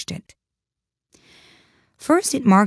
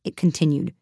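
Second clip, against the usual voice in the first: ordinary delivery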